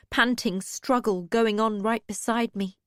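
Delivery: ordinary delivery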